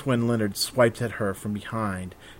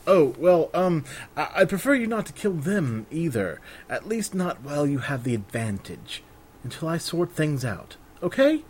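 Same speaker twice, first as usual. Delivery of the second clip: higher, hesitant pleading voice, rising hard on Okay; flustered bard; 5 semitones higher than usual. Acted. Bard talking Raven out of the killing, higher and flustered. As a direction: fumble the words, then beg agreement.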